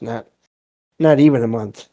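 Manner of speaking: ordinary talk, nothing marked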